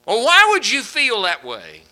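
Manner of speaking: loud, high exasperated yell; upset scolding. Retold or imitated. Mock-yelling at a relative who made a mistake, loud and high. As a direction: blow up at the family member. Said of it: "we get all upset and ... say".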